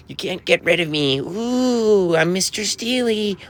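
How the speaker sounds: high, hammed-up wailing figure; silly character voice. Streamer entertaining viewers as Mister Steely, silly high voice. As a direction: swoop up high and draw out the "ooh".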